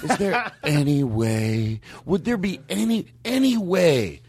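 drawn-out, rising, pleading ask; that Brian Regan voice. Retold or imitated. [name] redoing his request to the worker, slow and pleading. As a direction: stretch the words like a meek comedian. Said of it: "I did it with that voice".

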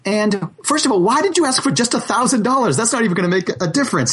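high, disbelieving telling-off; exasperated scolding. Retold or imitated. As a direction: scold him with rising disbelief. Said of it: "I'm like".

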